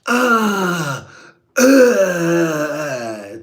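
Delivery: mock moaning, high and strained. Mocking a viewer's lady, high hammed-up moan. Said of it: "to my fucking voice".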